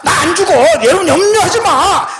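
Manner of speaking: high-pitched, rousing call; Paul proclaiming. Acted. Paul rallying frightened shipmates, high rousing cry. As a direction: call it out high, urging everyone on. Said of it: "지금 바울이 이렇게 얘기합니다".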